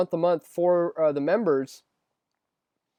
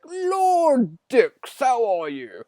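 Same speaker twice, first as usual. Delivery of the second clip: high mock English accent, lord-style greeting; 8 semitones higher, 5 dB louder, much slower than usual. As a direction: greet him like an English lord, much higher. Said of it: "he always does it with some sort of accent".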